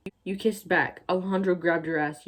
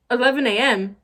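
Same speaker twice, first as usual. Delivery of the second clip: incredulous; 5 semitones higher, 7 dB louder than usual. Real, not acted. Disbelief at the time given.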